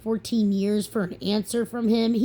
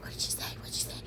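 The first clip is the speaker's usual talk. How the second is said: hushed whisper of a curious onlooker; 13 dB quieter, much faster than usual. Retold or imitated. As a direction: whisper it so nobody hears. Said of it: "you can hear her whispering".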